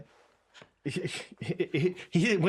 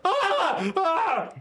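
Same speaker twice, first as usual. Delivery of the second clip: high, short yelps; mock pained cry; 13 semitones higher than usual. Co-host acting out a broken leg on air, mock screams. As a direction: yelp twice as if hurt.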